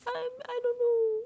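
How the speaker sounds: very high fake-tearful whine; mock little-guy crying. Imitating Vince's little guy act, high mock crying. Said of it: "I'm just a little guy".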